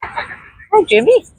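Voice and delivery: high voice for a dog; high-pitched pet greeting. Real, not acted. Vlogger greeting a dog going by, high-pitched hello.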